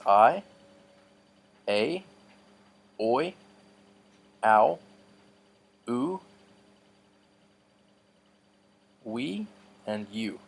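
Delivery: I, A, oi, ow, oo, we, U said one by one with pauses; each Greek diphthong sound spoken clearly and separately; diphthong sounds demo. Shown as a demonstration. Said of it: "they would sound like this".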